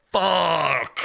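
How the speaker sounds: loud, cracked curse; real exasperated cry; frustrated outburst. Real, not acted. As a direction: blurt it out in frustration. Frustrated at time running out.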